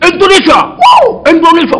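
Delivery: high, loud mock astonishment; excited exclamation. Preacher imitates chef thrilled to meet an Indonesian, excited exclamation. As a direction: cry out the country's name in delight. Chinese chef's delight at hearing Indonesia.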